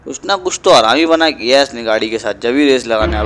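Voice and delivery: normal talking voice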